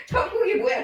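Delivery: high-pitched imitation of a deaf old woman yelling; imitated yell. The pastor imitating the grandmother yelling back, high-pitched. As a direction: pitch it way up, as a hard-of-hearing grandma. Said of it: "소리를 지르시고".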